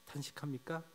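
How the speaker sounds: ordinary delivery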